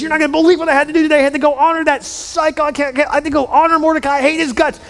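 high, fast, exasperated rant; hammed-up tirade, words tumbling out; angry venting. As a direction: spit out the grievance in a high pitch. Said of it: "He goes home in anger".

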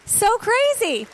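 high, slow, drawn-out amazement; amazed. Real, not acted. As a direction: savor the wonder, slow and high. Marveling at the outcome.

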